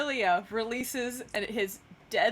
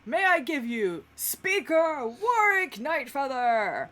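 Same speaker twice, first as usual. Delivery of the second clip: grand announcement; 3 semitones higher, 4 dB louder than usual. Acted. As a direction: announce the speaker like a herald to a crowd. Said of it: "and goes".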